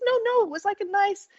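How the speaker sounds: high, imitated New Orleans reassurance; New Orleans accent. Worker reassures woman about her perfume, imitated New Orleans accent. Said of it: "New Orleans accent".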